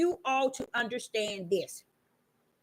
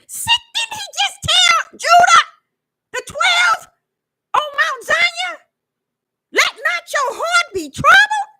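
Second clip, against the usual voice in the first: crying out the scripture, much higher and louder; full-force preaching; 14 semitones higher, 9 dB louder than usual. Acted. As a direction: shout it over the congregation like a preacher on fire.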